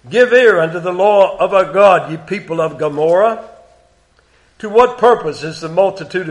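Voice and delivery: solemn scripture reading. Acted. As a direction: read it as God's complaint against Israel.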